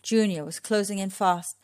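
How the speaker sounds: ordinary delivery, nothing marked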